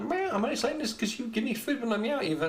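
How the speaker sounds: high-pitched cat, half laughing; talking cat voice. Acted. Cat meowing to its human for food, high voice. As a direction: meow and keep talking as the cat.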